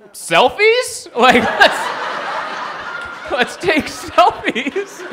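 very high, laughing, slow giddy suggestion; giddy idea. Pretend. Comic rioter suggesting selfies, high giddy laughing squeal. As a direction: squeal it like a tourist with a brilliant idea. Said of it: "someone was like".

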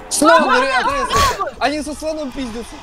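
high, loud excited yell; excited shout. Real, not acted. Animals suddenly fighting in game.

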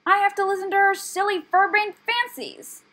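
high, loud aggrieved tirade; shrieky, insulted outburst; bristling anger. A narrator's reading. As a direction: complain furiously at full pitch. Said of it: "bristling with anger".

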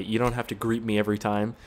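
ordinary delivery

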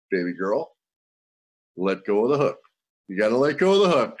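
calm under pressure. Retold or imitated. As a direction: soothe her without alarm. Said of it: "he's pretty cool under pressure".

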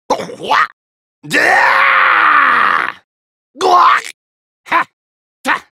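high, loud fighting yells; short explosive attack shouts, one drawn out; battle cries. Acted.

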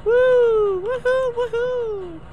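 very high whooping cheers; gleeful falsetto woohoo; cheering. Real, not acted. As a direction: whoop it up in triumph. Celebrating a game moment.

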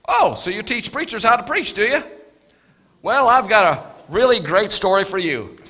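hearty stranger, pleased with himself; eager old storyteller. Acted. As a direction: lean in excited to tell it. Old man telling story, eager.

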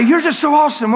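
loud, high mock gushing; gushing praise. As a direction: shout the flattery, hammed up. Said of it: "Now they say".

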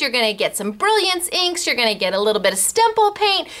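ordinary talk

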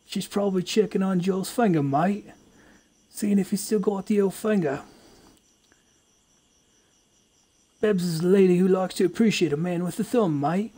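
jokey Australian bloke gossiping; Australian accent. Acted. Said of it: "Accents are too easy".

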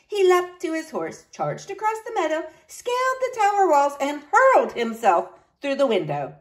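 high, dramatic storybook reading; exciting story narration. A narrator's reading. Teacher reading the knight's rescue, animated narration. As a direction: build the rescue for young listeners.

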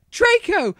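high, loud cry of the name; calling out. A narrator's reading. Heroine spotting Draco in the woods, calling out. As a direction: call out to him across the woods.

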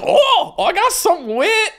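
very high, loud mimicked squeal; imitated Newfoundland lady. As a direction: mimic a woman yelping as waves drench her. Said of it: "and she goes".

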